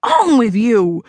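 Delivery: angry barking scolding, rising high. Acted. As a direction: snap at mother in rising anger. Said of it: "I barked some more".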